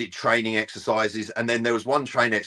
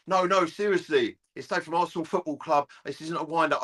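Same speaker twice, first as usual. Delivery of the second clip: higher, pleading club caller; insistent caller; 7 semitones higher than usual. Retold or imitated. Arsenal caller insisting to Martin, higher voice. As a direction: insist it's no wind-up, pitched up. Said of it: "he went".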